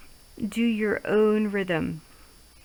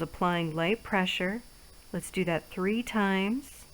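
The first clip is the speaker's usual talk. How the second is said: guided relaxation; 2 semitones lower, 4 dB quieter than usual. Acted. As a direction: ease them through each stroke softly. Leads guided face massage.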